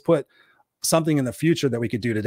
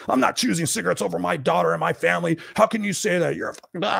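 higher-pitched indignant retold outburst; mad; 5 semitones higher than usual. Smoker protesting to his coach about choosing cigarettes, mad. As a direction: imitate an offended man protesting hotly. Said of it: "he got mad. He's like".

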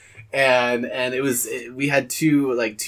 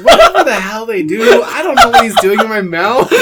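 laughing; 9 semitones higher, 10 dB louder than usual. Real, not acted. Laughing while telling.

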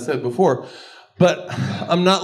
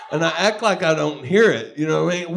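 ordinary talk, nothing marked

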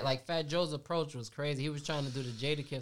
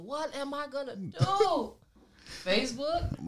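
panicked whine; 12 semitones higher, 4 dB louder, slower than usual. Retold or imitated. Mocking islanders panicking about outage.

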